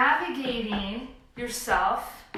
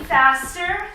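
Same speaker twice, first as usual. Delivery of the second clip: sharp, louder push to speed up; loud urging call; 6 semitones higher, 8 dB louder than usual. Real, not acted. Instructor urging the class to speed up, loud. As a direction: drive them faster.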